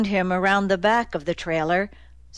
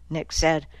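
ordinary delivery, nothing marked

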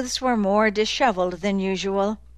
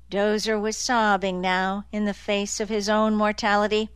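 ordinary delivery

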